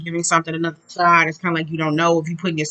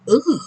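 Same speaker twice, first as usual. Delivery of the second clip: mock groan; 7 semitones higher, 5 dB louder than usual. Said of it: "And I be like".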